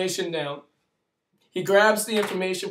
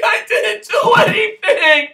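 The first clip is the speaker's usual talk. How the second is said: much higher, louder desperate cry; desperate shout; 11 dB louder than usual. Retold or imitated. Speaker shouting innocence at the officer, desperate. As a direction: cry out that you did nothing. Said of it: "I'm like".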